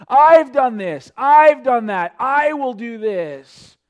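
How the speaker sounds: mocking the rich man's proud "I've", loud and slow; boastful rich man. Preacher imitating the proud rich man for the congregation, loud mock boast. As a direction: sneer out each "I" like a braggart. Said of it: "no acknowledgement of God's goodness".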